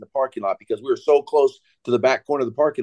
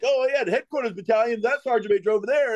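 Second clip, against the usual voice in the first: lightly imitated, raised-pitch roll call; sergeant major speech; 9 semitones higher than usual. Sergeant major introducing battalion leaders, lightly mimicked higher voice.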